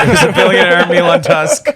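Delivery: lightly voiced walrus billionaire; a hint of a pompous walrus. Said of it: "Milan Tusk is my walrus character".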